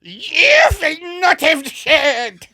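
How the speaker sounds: very high, loud, drawn-out mock German; attempted German accent. Shown as a demonstration. Trying to copy the grandfather's order, shrieked botched German accent. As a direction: overblown German shout, badly done. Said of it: "Let me try that one".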